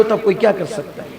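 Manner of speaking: ordinary talk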